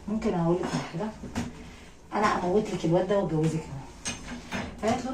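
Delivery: usual speaking voice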